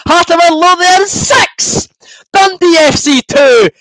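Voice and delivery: loud, high stadium announcer call; score announcement. Pretend. Fan announcing the score to his viewers like a stadium announcer.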